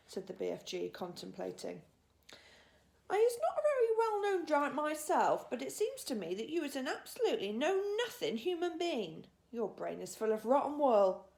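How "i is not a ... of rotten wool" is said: higher, louder giant voice, mock scolding; a cheeky old giant telling her off; grumpy giant. A narrator's reading. BFG scolding Sophie.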